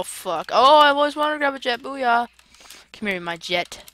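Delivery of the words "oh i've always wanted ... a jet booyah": excited. Real, not acted. Grabbing a jet in game.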